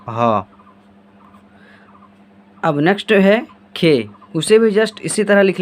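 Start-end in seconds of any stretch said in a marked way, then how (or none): 0.1-0.4 "ह" breathed out as a short h-sound, dropping low; letter sound demo. Shown as a demonstration. Teacher models the sound "ह" for pupils, low and clear. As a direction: give students the clean sound "ह". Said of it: "इसका उच्चारण क्या हो जाएगा".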